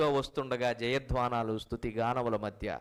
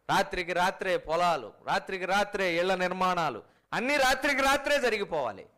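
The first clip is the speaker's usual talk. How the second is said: climbing higher line by line; mounting mock list; 4 semitones higher than usual. Preacher mocking impatient believers, rising mock list. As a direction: pile up the demands to a peak.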